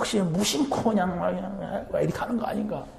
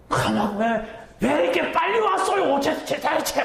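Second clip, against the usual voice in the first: high, stammering, flustered protest; whiny complainer; 6 semitones higher than usual. Retold or imitated. Believer caught unready at the Lord's return, high stammering protest. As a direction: sputter out the complaint, caught off guard.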